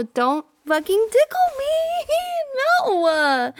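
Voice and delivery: high squealing protest breaking into laughter; ticklish squeal. Acted. Girlfriend begs partner not to tickle, squealing protest. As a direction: squeal helplessly while being tickled.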